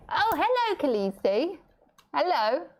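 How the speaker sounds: high sing-song pet voice; baby talk to pet. Acted. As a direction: coo a greeting to the bird, high up. Greeting her pet at dinnertime.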